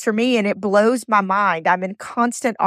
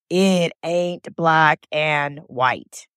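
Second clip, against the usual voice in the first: slow quoted saying; 3 semitones lower, much slower than usual. Retold or imitated. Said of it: "like my friend Joel says".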